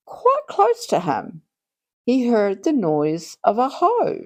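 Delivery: ordinary delivery, nothing marked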